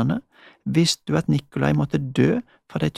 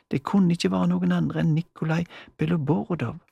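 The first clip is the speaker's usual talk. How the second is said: ordinary delivery, nothing marked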